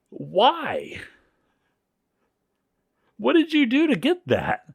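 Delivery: high, mock-flabbergasted demand; astonished questioning. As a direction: act out amazed disbelief, voice shooting up. Journalist astonished by the award.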